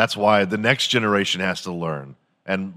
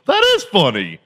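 moron proofreader; 8 semitones higher, 5 dB louder than usual. Acted. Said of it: "and he goes".

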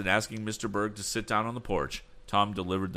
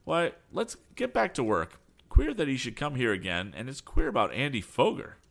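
put-on higher-pitched, lively young lad; lightly voiced lad; 6 semitones higher than usual. A narrator's reading. Son talking to his father about the agent, lightly voiced, higher. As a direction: pitch the son above the narration.

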